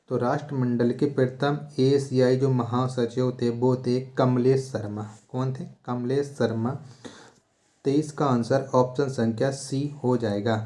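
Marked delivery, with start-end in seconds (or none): none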